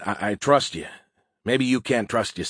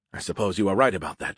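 usual speaking voice

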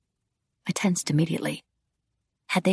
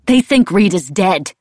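loud, angry retort; heated accusation; spat out; 3 semitones higher, 7 dB louder than usual. A narrator's reading. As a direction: spit the words at him. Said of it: "I spat".

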